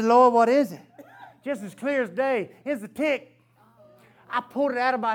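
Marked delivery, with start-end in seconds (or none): 0.0-0.8 laughing prayer. Retold or imitated. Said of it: "I said".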